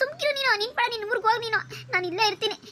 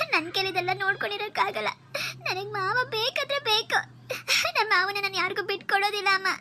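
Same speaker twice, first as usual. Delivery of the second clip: ordinary talk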